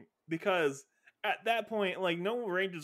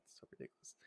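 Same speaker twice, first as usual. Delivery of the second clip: whispered aside; whispering; 23 dB quieter, faster than usual. Real, not acted. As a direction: breathe it out in a whisper.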